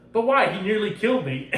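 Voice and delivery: high, loud, wounded complaint; mock protest. Inquisitor protesting to the Emperor after nearly dying, mock-aggrieved. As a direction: plead in acted disbelief.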